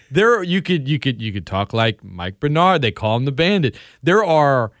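ordinary talk

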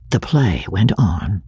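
ordinary delivery

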